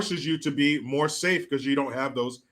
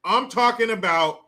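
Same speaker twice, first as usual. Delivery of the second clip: normal talking voice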